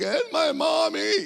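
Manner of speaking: high, slow blubbering in a put-on voice; sobbing child voice. Sobbing to the cop about his mother, mock blubbering. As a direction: blubber it out like a crying kid. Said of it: "I'm like".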